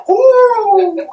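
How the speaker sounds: high, loud "whoo" of a gust; wind whoosh. Acted. Game master voicing a cold breeze, high wind howl.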